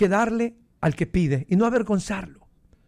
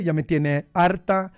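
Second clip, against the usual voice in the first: ordinary delivery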